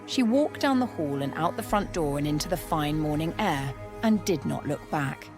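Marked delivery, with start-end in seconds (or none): none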